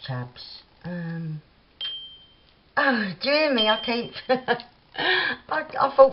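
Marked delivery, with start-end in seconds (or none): 2.7-6.1 laughing while talking; giggly, amused. Real, not acted. Laughing at own bell noise.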